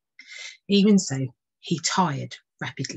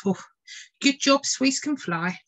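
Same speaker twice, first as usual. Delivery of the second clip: bird thinking; 8 semitones higher than usual. Acted. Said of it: "he thought".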